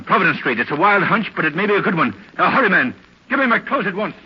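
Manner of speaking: high, driving eagerness; excited urgency. Acted. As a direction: seize on a hunch and hurry him along. Seizes on clue, rushes off.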